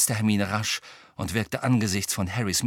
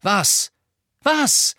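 loud, high, impatient demand; pressing, urgent; 11 semitones higher, 7 dB louder than usual. A narrator's reading. Harry presses his stunned friends for an answer, urgently. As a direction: push for an answer, louder and higher the second time. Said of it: "drängte Harry".

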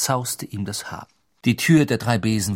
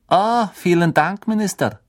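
charming official voice; 7 semitones higher, 5 dB louder than usual. Acted. Voiced figure.

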